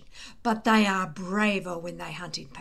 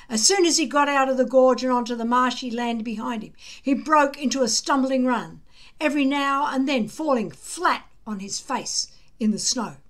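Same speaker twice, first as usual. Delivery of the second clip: ordinary delivery, nothing marked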